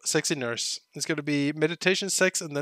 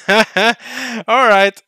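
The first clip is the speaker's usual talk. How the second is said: laughing; 5 semitones higher, 14 dB louder than usual. Real, not acted. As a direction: burst of real laughter. Laughs at dog line.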